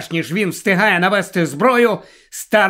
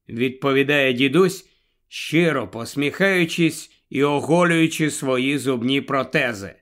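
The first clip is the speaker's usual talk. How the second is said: ordinary delivery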